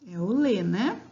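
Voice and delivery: ordinary talk, nothing marked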